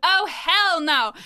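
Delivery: loud, high, drawn-out refusal; indignant refusal. Retold or imitated. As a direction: act out a friend's slow outraged objection. Friend's indignant reply, retold.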